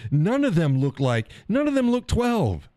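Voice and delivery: breaks into a laugh, then jumps way up high on "twelve"; laughing disbelief. Real, not acted. Comparing old drill sergeants to today's, laughing in high disbelief. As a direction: laugh through it, voice shooting up in disbelief.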